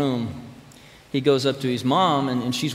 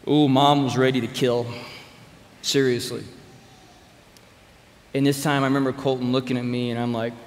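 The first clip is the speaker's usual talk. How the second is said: usual speaking voice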